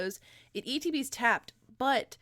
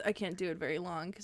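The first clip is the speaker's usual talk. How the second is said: ordinary talk, nothing marked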